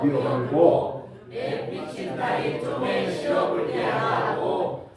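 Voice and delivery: Psalm read in broad, slow Jeolla dialect. Pretend. Pastor leads congregation in Jeolla dialect psalm, slow and sing-song. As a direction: drawl the psalm like a Jeolla grandma, half sung. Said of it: "전라도 버전으로 한번 해볼게요".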